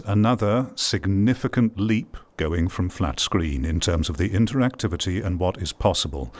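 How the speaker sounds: ordinary talk, nothing marked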